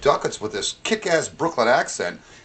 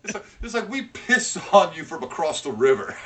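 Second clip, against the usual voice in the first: ordinary talk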